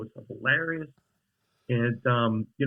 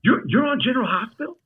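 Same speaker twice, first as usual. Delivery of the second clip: high, louder stammered question; astonished fan; 11 semitones higher, 5 dB louder than usual. Retold or imitated. Old fan finding out the guest is on the show, high astonished stammer. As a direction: imitate a fan's stunned disbelief. Stunned fan learns he's an actor.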